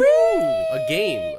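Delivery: very high, loud whoop; excited cheer. Real, not acted. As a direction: cheer like the game just started. Cheering the trivia game.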